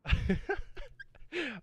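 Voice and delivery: high bursting laugh. Real, not acted. Laughing at own reaction.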